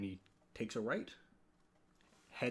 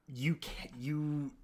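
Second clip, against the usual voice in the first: whispering; 3 semitones higher, much slower than usual. Acted. GM whispering a detail.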